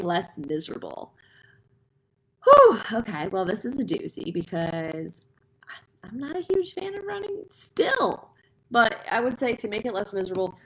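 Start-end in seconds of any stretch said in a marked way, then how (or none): 2.4-3.3 loud whew. Real, not acted. Reacting to running question. 3.3-8.3 hushed confessional whisper. Real, not acted. Confessing dislike of running.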